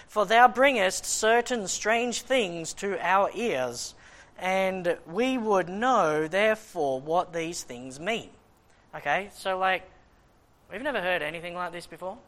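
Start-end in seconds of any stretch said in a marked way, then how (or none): 0.1-8.3 slow, louder formal Bible reading; measured, declaiming King James reading; scripture reading. Acted. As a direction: read it out like holy writ. Reads scripture aloud.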